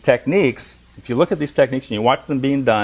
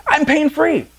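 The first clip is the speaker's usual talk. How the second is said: much higher, thrilled phone voice; Dwight calls his therapist, giddy and much higher; excited Dwight; slower than usual. Retold or imitated. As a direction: burst with news on the phone. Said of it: "He goes".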